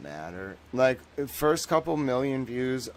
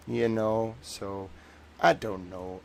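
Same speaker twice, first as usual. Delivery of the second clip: ordinary talk, nothing marked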